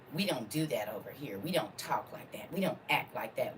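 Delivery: soft, prim mock of church people; hushed mocking of self-righteous church members; mock church folk. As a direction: imitate the snooty congregation quietly. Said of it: "people say church people".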